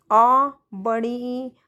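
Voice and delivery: "आ" and "बड़ी ई" drawn out slowly, vowels held long; slow, held vowel sounds for the class; long vowels demo. Shown as a demonstration. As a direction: stretch each vowel out like a teacher showing length. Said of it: "दो मात्रा का समय लगता है".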